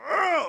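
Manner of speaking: loud, high exasperated groan; re-enacted groan at the end of patience; frustrated growl. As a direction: let out a burst of exasperation. Retelling his own flash of frustration.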